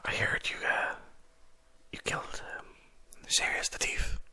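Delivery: soft unvoiced whisper; whispered noble. Lord Emerald quietly approaching the party, whispering. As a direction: lean in and whisper it to them. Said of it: "He's like".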